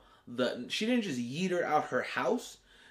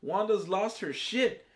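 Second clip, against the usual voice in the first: excited fan reaction; 7 semitones higher than usual. Retold or imitated. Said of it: "And I was like".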